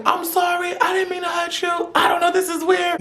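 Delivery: very high, squeaky mock crying; hammed-up weepy high voice; tearful apology. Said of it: "I got tears in my eyes".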